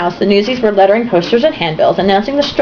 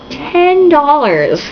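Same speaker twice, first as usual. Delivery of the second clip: mock awe; 5 semitones higher, much slower than usual.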